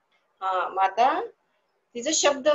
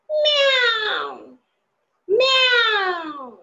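high, drawn-out, loud meowing like a cat; 12 semitones higher, 6 dB louder, much slower than usual. Shown as a demonstration. Teacher meowing for the class to guess the animal, high and drawn-out. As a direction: stretch it into a long, loud cat's meow. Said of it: "ते कोणाचा आवाज आहे ते मला सांगायचा आहे".

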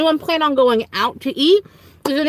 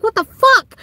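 startled disbelief; 11 semitones higher, 4 dB louder than usual. Retold or imitated. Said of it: "I was like".

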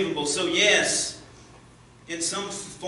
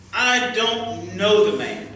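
slow, loud, deliberate oath; swearing denial; 7 semitones higher, 7 dB louder, slower than usual. A narrator's reading. Peter swearing to the bystanders, slow and loud. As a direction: spit out each word as a sworn oath. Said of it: "he swore to them".

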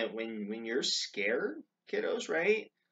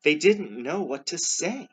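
high, loud storybook reading; projected narration; 7 semitones higher, 9 dB louder than usual. A narrator's reading. Reader telling children of speechless disciples, projected narration. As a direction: read it out boldly to the children. Story line read, then repeated.

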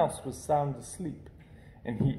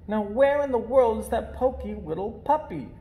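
higher puzzled puppy voice; puppies wondering; 7 semitones higher than usual. Acted. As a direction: wonder aloud.

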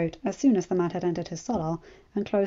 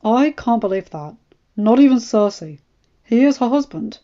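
protesting; 3 semitones higher, 8 dB louder than usual. A narrator's reading. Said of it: "Ned protested".

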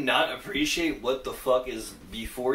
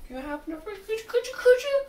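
much higher, mock shy voice, stammering the request; imitating the fan; faster than usual. Reactor mimicking the Irish fan asking for a picture, high mock shy voice. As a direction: squeak it out like a starstruck fan. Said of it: "and, like".

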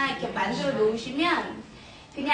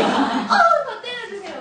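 put-on high, loud, slow kid squeal; imitated children's gleeful plea; delighted children; 9 semitones higher, 8 dB louder than usual. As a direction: beg eagerly like excited children. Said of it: "애들이 이제".

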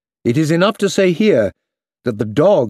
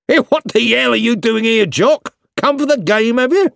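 higher, louder laughing call; cheery soldier shout; 10 semitones higher, 4 dB louder than usual. Acted. Soldier calling to Jock mid-charge, cheerful and loud. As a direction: hail the dog like an old mate.

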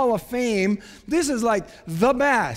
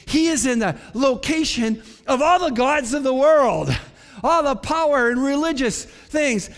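loud, raised sermon voice; forceful preaching; 4 semitones higher, 4 dB louder than usual. Real, not acted. Preacher places Jesus among the world's gods, loud and forceful. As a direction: drive the point out to the whole congregation. Preacher drives his point home.

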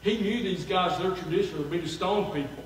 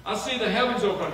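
loud, higher cry of a vision; yelling out; 6 semitones higher, 4 dB louder than usual. Acted. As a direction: call it out to the crowd in wonder. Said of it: "he yells out".

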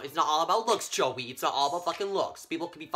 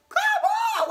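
high, loud squeal of come on; mock exasperated squeal; exasperated shriek; 7 dB louder than usual. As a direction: squeal it like you can't believe it. Exasperated at another supply teacher.

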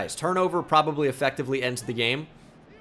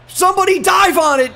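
much higher, loud pleading shout; frantic yell; 10 semitones higher, 8 dB louder than usual. Real, not acted. Gamer begging his team to recover the onside kick, frantic yell. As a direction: beg your players to jump on it.

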